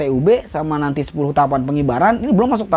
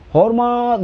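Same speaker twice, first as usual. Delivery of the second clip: aba-aba hormat; 6 semitones higher than usual. Shown as a demonstration. Demonstrating an over-rounded command.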